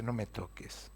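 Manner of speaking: soft Jesus. Acted. Jesus speaks softly to Mary.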